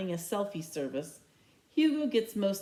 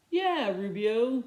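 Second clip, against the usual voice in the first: slow, scornful snort of a line; big teammate scoffing, slow and far off; 4 semitones higher, 4 dB louder, slower than usual. A narrator's reading. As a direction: sneer it out slowly at him. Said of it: "Bear snorts".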